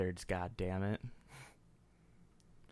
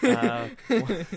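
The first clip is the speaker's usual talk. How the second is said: laughing; 11 semitones higher, 8 dB louder than usual. Real, not acted. Laughs mid-sentence.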